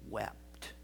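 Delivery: breathed, near silent; hushed reverent whisper. Acted. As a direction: drop it to a whisper. Climax repetition hushed.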